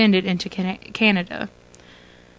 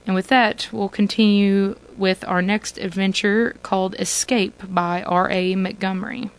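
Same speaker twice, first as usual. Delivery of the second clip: ordinary delivery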